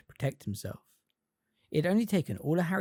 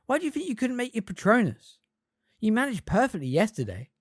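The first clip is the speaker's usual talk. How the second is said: put-on worried girl's voice; 8 semitones higher, 4 dB louder than usual. A narrator's reading. Hermione questioning anxiously.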